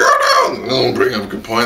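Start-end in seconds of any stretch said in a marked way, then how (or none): 0.0-0.5 high, airy gasping oh no; mock shock. Reviewer mocking characters' dramatic realizations, high squeal. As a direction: squeal it in fake alarm. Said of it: "they're like".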